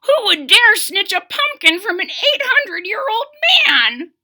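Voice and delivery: put-on high, loud cracked old-man voice; excited old man croak; croaky old man.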